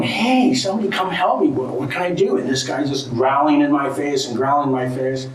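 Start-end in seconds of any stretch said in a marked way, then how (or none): 0.0-2.4 high, mock-desperate call for help; a hint of a panicked shout; retold yell for help. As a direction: call out to the watching village, half-acted.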